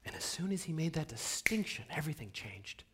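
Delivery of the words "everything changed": hushed, breathy whisper of awe. Acted. Speaker telling the conductor's story, whispering. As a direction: drop to a secret whisper.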